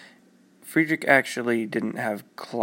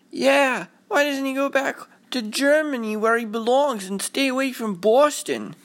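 very high, louder jeering voice; another mocking boy; 13 semitones higher, 3 dB louder than usual. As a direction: taunt like a mean neighborhood kid. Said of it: "another boy was like".

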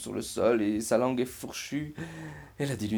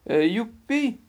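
higher, louder little burst of joy; squealed storybook hooray; excited cheer; 11 semitones higher, 7 dB louder, slower than usual. Acted. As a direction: cheer it out like a delighted mermaid. Mermaid rejoices over found key.

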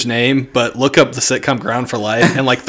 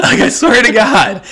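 ordinary delivery

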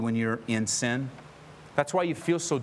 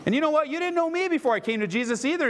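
high-pitched, loud comeback; excitedly re-enacting a conversation; animated retold reply; 14 semitones higher, 3 dB louder than usual. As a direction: act out an eager reply, pitched way up. Acting out his lively reply.

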